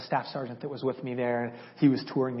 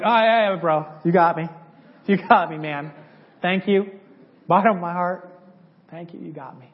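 teasing a buddy with hammed-up thanks; mock gushing thanks; 2 semitones higher, 6 dB louder than usual. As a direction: lay the thanks on thick. Sarcastic thanks to friend.

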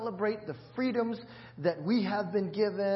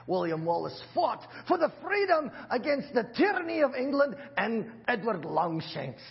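hammed-up Scottish accent, higher and louder; 3 semitones higher, 3 dB louder than usual. Shown as a demonstration. Pastor shows off his Scottish accent to the church. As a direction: play William Wallace's Scotsman for the congregation. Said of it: "Pretty good, huh?".

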